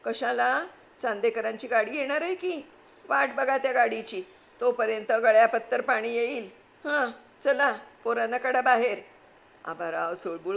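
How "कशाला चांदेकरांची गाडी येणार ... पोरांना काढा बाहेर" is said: high, loud, scolding housewife in a panic; frantic aunt. Acted. Wife panicking at husband as flood comes, frantic scolding. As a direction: snap at the husband while grabbing valuables. Kaku hurries everyone.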